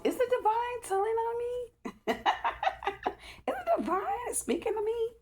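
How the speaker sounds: high, delighted squeal of surprise; giggling high-pitched disbelief; surprised squeal. Real, not acted. Surprised reaction to the card.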